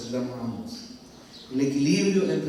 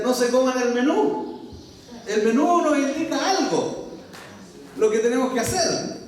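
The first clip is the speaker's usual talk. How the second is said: quoting Wimber emphatically; 6 semitones higher than usual. Retold or imitated. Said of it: "Wimber tenía esta frase, decía".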